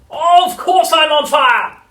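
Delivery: put-on high, drawn-out old-woman screech; exasperated shrieking grandma; furious yell. A narrator's reading. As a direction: yell it indignantly at full volume. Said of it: "she yelled".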